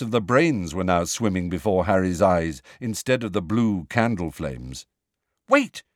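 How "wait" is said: high, loud, sudden call; sharp high cry to stop. A narrator's reading. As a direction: cut in sharply, pitch leaping. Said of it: "said Hermione sharply".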